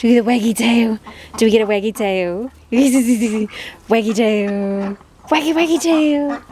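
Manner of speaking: sing-song pet talk coaxing a tail wag. Real, not acted. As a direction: coo at the dog playfully. Baby talk to dog.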